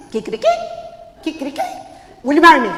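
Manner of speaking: loud, excited rooster proposal; hyper rooster suitor. Acted. Señor Gallo proposing to Martina, hyper rooster voice. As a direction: blurt the proposal at full volume.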